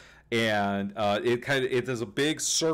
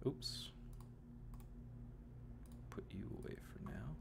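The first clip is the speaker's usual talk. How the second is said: muttered aside; 19 dB quieter, faster than usual. Real, not acted. GM mutters while moving map tokens.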